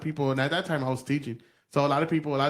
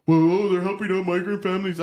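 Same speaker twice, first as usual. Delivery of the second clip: a raised, sneering take on their excuse; mocking whiny voice; 4 semitones higher, 4 dB louder than usual. Podcaster mocking online commenters, sneering. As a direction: parrot them with contempt.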